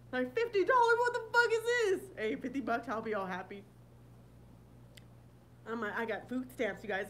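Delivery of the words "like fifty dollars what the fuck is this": high, loud, hammed-up indignation; mock outrage. Imagined welfare applicant complaining about fifty dollars, mock outrage. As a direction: act outraged, pitched way up and loud. Acting out outrage at small benefit.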